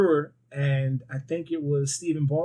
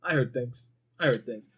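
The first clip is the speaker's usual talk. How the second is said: De Niro impression; 3 semitones lower, 4 dB quieter than usual. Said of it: "And you go".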